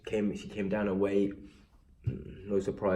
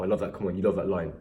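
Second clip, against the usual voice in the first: usual speaking voice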